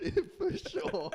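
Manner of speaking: high, slow laughing "for sure"; laughing sarcasm. Retold or imitated. Friend mocking his dash to the toilet, laughing. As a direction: squeal it through laughter. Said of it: "I was like".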